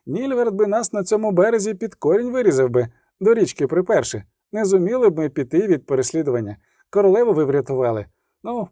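a kindly orderly soothing his patient; chatty field medic. Acted. Medic thanks and leaves. (балакучий фельдшер заспокоює відьмака)

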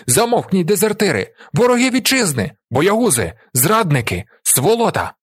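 high, outraged string of insults; seething anger. A narrator's reading. Commander abusing the men as traitors, boiling with anger. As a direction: hurl each insult separately. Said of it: "аж кипить від злості".

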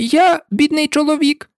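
a frightened hatter begging; trembling voice. A narrator's reading. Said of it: "вів своє капелюшник".

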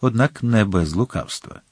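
ordinary delivery

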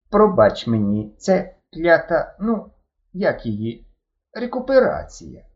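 ледь змінений, повільніший голос шофера; трохи чужим голосом, повільно; інший голос. A narrator's reading. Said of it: "докінчив іншим голосом". (шофер раптом говорить іншим голосом, повільно)

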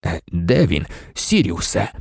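low, tense question; tense, unusual voice. A narrator's reading. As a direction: speak low, with strain in it. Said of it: "заговорив незвичним, напруженим голосом".

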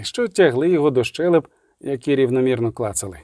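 usual speaking voice

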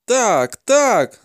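high, frantic admission; captive's cry. A narrator's reading. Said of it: "закричав полонений".

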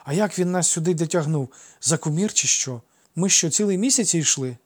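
put-on high, loud boyish questions; puzzled childish voice; incredulous child. A narrator's reading. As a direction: wonder aloud in disbelief. Boys puzzle over their journey.

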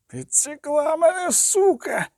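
high, strained, cursing cry from the ice; a man clawing his way up, voice pushed high in pain; high panicked voice, slow and pained; struggling wounded man. A narrator's reading. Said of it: "дерся Оль Гарзгайм".

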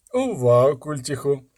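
a light lisp, mocking; lisping thug. A narrator's reading. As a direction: play the lisping robber teasing. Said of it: "трохи шепелявив".

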